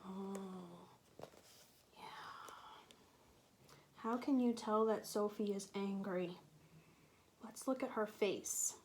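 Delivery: ordinary delivery, nothing marked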